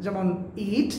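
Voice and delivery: usual speaking voice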